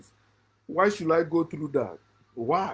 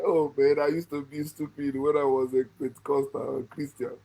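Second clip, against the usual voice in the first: ordinary talk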